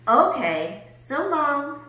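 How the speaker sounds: loud cheerful farewell, rising high. A narrator's reading. Rosie says goodbye to grumpy Hank, cheerfully. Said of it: "said Rosie cheerfully".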